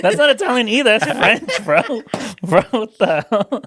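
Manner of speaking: high laughing disbelief. Real, not acted. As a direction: crack up while correcting them. Laughing at fake language.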